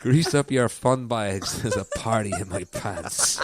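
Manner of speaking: slow, loud Irish accent, stretched out. Shown as a demonstration. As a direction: give it in a broad Irish accent. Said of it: "You have to say it in an Irish accent".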